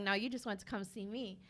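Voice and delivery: ordinary talk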